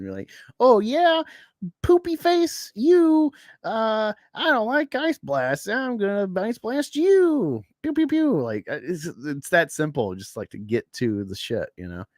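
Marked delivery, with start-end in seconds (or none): 0.6-8.4 put-on much higher, slow, silly child voice; mock little kid. Mocking how a child would write it, squeaky kid voice. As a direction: play a kid making up a superhero fight. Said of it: "a child would get straight to the action".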